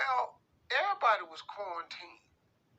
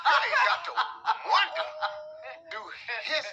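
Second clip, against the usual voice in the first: much higher, louder exasperated yelling; incredulous shout; 8 semitones higher, 4 dB louder, slower than usual. Acted. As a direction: yell it in disbelief at her. Mocking the letter writer.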